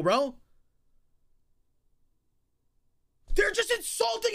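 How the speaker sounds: furious shouting rant; berserk rage. Real, not acted. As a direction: yell at them, outraged. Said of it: "literally screaming at the top of my lungs".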